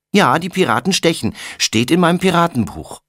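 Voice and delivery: put-on a small kid who knows he's right; eager little boy. A narrator's reading. Little brother defends his pirate book, high eager insistence.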